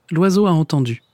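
ordinary delivery, nothing marked

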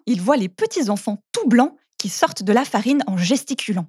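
ordinary delivery, nothing marked

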